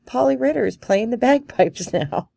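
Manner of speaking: high, loud, laughing through the words; laughing reply. Retold or imitated. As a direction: laugh while you set her straight. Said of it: "Peggy laughed and said".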